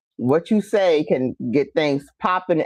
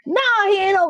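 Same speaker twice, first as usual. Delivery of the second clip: retort; 14 semitones higher than usual. Retold or imitated. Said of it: "answering the aunt".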